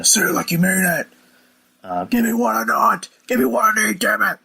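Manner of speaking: hammed-up angry demand. Shane yelling at the announcer, high hammed-up rant. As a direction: get in his face and yell it. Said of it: "he's getting mad at him and getting his face".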